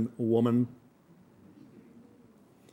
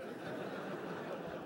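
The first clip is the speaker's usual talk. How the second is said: soft laugh; 17 dB quieter than usual. Real, not acted. Laugh after joke.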